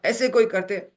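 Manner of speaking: husband shouting at his wife over the sari; angry shout. Retold or imitated. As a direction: yell it at her. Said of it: "ये डांट रहा है".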